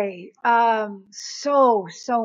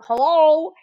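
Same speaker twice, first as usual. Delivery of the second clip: louder, higher questioning hello; man answering phone; 5 semitones higher, 6 dB louder than usual. Retold or imitated. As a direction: imitate him calling hello down the line.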